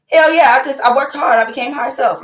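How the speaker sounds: high, loud burst of self-congratulation; elated triumph. Retold or imitated. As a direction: exult in your own breakthrough. Retelling her elated thought.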